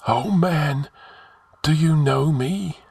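high, hollow voice of the long dead; sepulchral. A narrator's reading. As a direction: intone it high like an ancient spirit. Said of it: "spoke in sepulchral tones".

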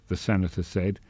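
ordinary delivery, nothing marked

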